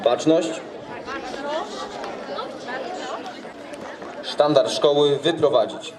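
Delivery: slow drawn-out parade command; drill sergeant. Real, not acted. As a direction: call out formal orders to the ranks. School ceremony, flag party commands.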